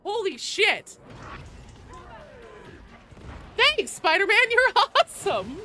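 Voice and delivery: loud, high burst of thanks; excited shout. Real, not acted. Streamer yells thanks to Spider-Man, excited. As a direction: yell thanks at the donor in delight. Thanking donor after big popper.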